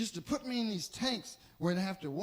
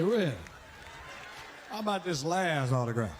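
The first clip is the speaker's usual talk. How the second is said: lower, sly request; lightly voiced brother pushing for autograph; 7 semitones lower than usual.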